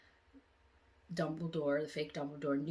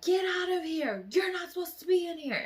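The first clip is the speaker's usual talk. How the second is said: much higher, louder scolding shout; angry teacher yelling; 12 semitones higher, 5 dB louder than usual. Retold or imitated. Older teachers barging in and yelling at the students. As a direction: chase the kids out. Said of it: "they were like".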